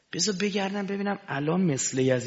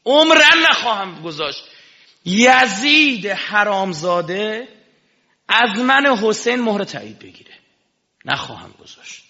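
defiant Imam; 6 semitones higher, 9 dB louder than usual. Acted. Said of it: "دم شمشیره دیگه ها".

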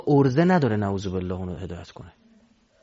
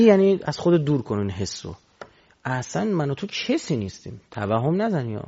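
ordinary talk

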